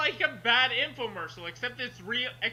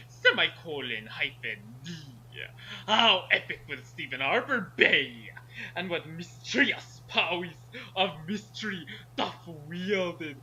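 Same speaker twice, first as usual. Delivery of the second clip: lower, announcer-style closing questions; dramatic closer read; 4 semitones lower than usual. Acted. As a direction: read it like a cliffhanger teaser. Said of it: "you wanna read the closer".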